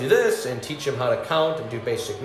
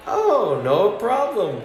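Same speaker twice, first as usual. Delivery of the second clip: cheery clerk; 8 semitones higher, much slower than usual. Retold or imitated. Yo answers the angry customer with eager cheer. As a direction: sell it like the happy helper.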